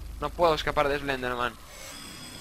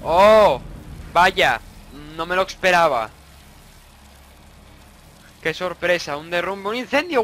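usual speaking voice

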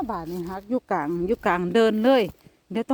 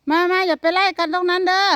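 high, loud calling; loud coaxing shout; calling the cats; 8 semitones higher, 6 dB louder than usual. Real, not acted. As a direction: call them off across the yard. Calling cats to stop chasing.

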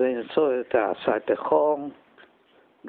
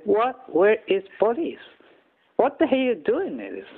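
1 indignant disbelief; 9 semitones higher than usual. Retold or imitated. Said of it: "I said".

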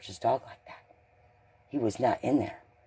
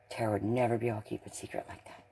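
normal talking voice

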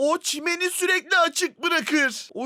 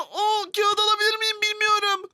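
usual speaking voice

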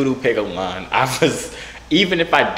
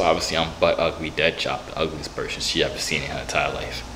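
low, fading self-pity; mock dejection; 9 semitones lower, 6 dB quieter than usual. Concluding they must be ugly, low mock dejection. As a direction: sink lower and quieter to the end.

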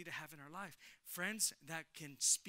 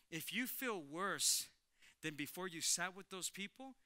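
normal talking voice

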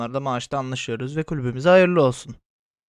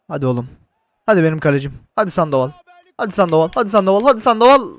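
urging shout; 4 semitones higher, 4 dB louder, faster than usual. Real, not acted. Urging keeper during penalty.

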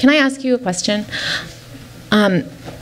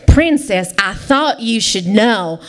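slow southern drawl; badly faked male southern twang; southern man fish; 3 semitones lower, slower than usual. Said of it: "it's a southern fish".

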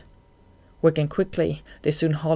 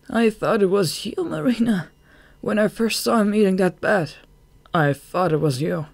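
horrified confession; 5 semitones higher, 4 dB louder than usual. A narrator's reading. Confession of horror to his friend.

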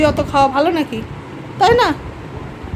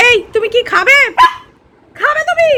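coaxing pet talk; 9 semitones higher, 4 dB louder than usual. Real, not acted. Coaxing a dog to eat.

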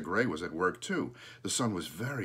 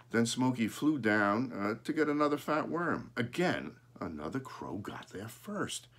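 usual speaking voice

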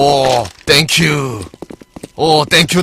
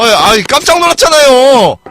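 higher, louder and slow, caught off guard; startled; 7 semitones higher, 7 dB louder than usual. Real, not acted. Gamer startled by a sudden jump noise, higher and louder. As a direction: yelp it out after a fright.

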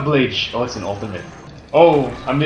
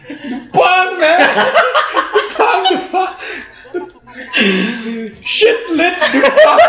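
high, laughing mock exclamations; laughing squeal; 12 semitones higher, much slower than usual. Real, not acted. Gamer laughing at his own silly word to teammate.

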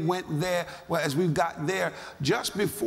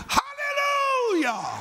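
long, high drawn-out hallelujah shout; a slow ringing shout of praise; joyful shout; much slower than usual. Retold or imitated. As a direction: cry it out in sudden overflowing joy. Jumping up on hearing healing.

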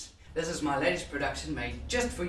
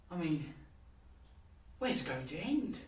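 subdued brooding character; 13 dB quieter than usual. Acted. Advising against thinking about eternity, quietly despondent. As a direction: sink into quiet dread, pausing between thoughts.

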